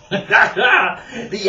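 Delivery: slow words through real laughter; still laughing. Real, not acted. Reader closing the chapter, still laughing. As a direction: speak it while still giggling.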